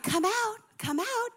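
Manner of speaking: high, lilting fairy call; sing-song, light good witch voice; Glinda the Good Witch. Retold or imitated. Good witch coaxing people from hiding, lilting. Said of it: "it felt like Glinda the Good Witch".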